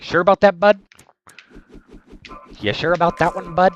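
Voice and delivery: imitating a chatter's Canadian "bud"; Canadian accent. Streamer imitating Canadian chatter JKT, hammed-up Canadian accent. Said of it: "it's a J K T phrase".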